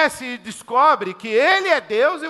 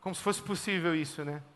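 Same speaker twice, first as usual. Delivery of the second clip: ordinary talk, nothing marked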